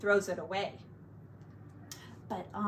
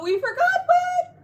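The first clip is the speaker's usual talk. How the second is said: mock surprise; 11 dB louder than usual.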